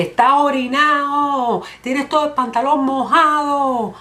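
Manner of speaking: high, drawn-out call; mock public announcement. Friend embarrassing balding man as a favor, mock public announcement. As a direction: stretch every word slowly for all to hear.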